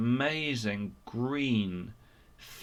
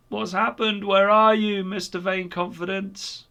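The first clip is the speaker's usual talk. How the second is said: loud, high calls into the dark; shouting out; 8 semitones higher, 4 dB louder than usual. A narrator's reading. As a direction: call out anxiously into the darkness. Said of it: "they shouted out".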